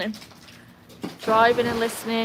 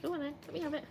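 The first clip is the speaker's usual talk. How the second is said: soft coaxing; 7 semitones higher, 11 dB quieter, much faster than usual. Real, not acted. Coaxing dog to drop ball.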